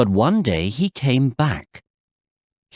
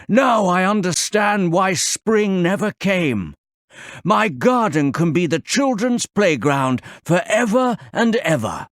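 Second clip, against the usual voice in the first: remorseful giant; 8 semitones higher than usual. Acted.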